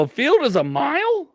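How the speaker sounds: high-pitched comic disbelief; incredulous question. Acted. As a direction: ask it high, as if astonished. Incredulous reply to friend's answer.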